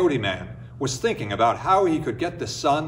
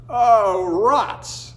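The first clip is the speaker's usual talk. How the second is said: muttered curse; 7 semitones higher, 4 dB louder than usual. Acted. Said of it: "muttered Oye".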